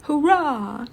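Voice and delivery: high, loud cheer in a different voice; shouted hurrah. Acted. The three dogs dancing before the coach, cheering loudly. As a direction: whoop it like a dancing dog. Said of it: "danced in front and shouted".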